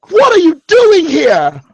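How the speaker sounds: slow, high-pitched outraged question; exasperated demand. Acted. Father demands answers from his long-lost son, exasperated. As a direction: draw out each word in exasperation.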